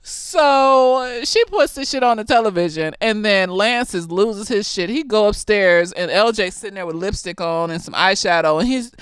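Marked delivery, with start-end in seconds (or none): none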